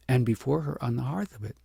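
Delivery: ordinary delivery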